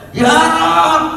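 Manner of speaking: a prophet's sudden high cry; Moses crying out. A narrator's reading. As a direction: call out high and urgent. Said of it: "Jerit Nabi Musa".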